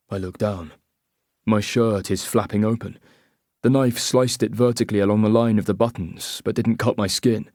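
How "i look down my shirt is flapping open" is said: ordinary delivery, nothing marked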